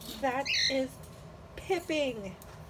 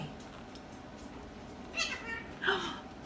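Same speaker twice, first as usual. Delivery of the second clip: playful gasp; 10 semitones higher, 9 dB quieter than usual. Real, not acted. Playing peekaboo.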